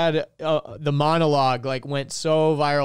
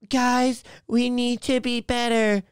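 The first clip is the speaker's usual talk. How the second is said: higher-pitched mock plea; mocking preachy do-gooder; 6 semitones higher, slower than usual. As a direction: imitate a sanctimonious celebrity plea, pitched up. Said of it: "It's like".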